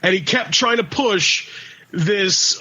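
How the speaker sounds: ordinary talk, nothing marked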